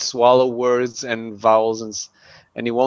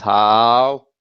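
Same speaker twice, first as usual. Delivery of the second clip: HOW stretched out, loud and rising; long vowel; 4 dB louder than usual. Shown as a demonstration. Showing learners the long vowel, HOW drawn out. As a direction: keep the vowel of HOW going. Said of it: "keep it long like this".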